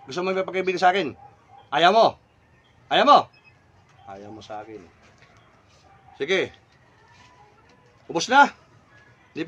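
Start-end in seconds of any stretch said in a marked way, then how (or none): 1.7-3.2 loud, short, insistent; pressing question. Real, not acted. Presses spirit about friendship. 4.1-4.8 much lower and softer; quiet acceptance. Real, not acted. Accepts the spirit's refusal quietly.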